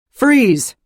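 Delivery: high, loud barked order; booming command. A narrator's reading. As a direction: boom it across the room. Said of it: "a voice boomed through the room".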